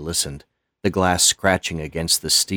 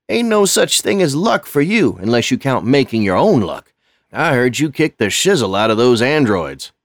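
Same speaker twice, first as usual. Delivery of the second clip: much higher and louder, teasing praise; folksy old custodian; 7 semitones higher, 6 dB louder than usual. Acted. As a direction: rib him with admiration. Lonnie's folksy voice.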